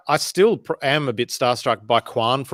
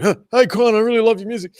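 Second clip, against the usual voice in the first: high, loud, overeager fan gushing; really intense; 9 semitones higher, 5 dB louder, faster than usual. Retold or imitated. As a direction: pour it on like a starstruck fan. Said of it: "I was, like, really intense".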